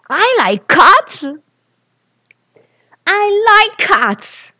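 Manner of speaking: put-on loud, slow, high child's shriek; furious screech. Angry little boy screeches at the mean kids, loud and slow. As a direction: scream it, small and furious. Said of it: "furious angel screeches in English".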